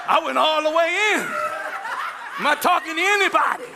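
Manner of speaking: laughing through the words. Real, not acted. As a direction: laugh it out loud. Laughing at own story.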